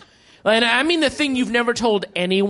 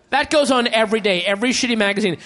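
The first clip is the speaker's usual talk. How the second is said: ordinary delivery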